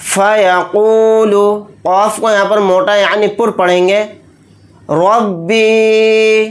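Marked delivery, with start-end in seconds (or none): 0.1-1.5 slow melodic tajweed chant, pitched higher; Quran recitation. Acted. Teacher reciting the ayah aloud in a slow chant. 4.9-6.5 rabi with a full, thick र and a long doubled ब; रब्बी said slowly with a heavy र. Shown as a demonstration. Teacher showing the heavy र in rabi. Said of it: "रा को यहाँ पर मोटा यानी पुर पढ़ेंगे".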